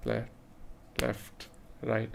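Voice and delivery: slow, soft left right; soft, unhurried marching count; slow walking count. Shown as a demonstration. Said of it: "someone walking at this pace".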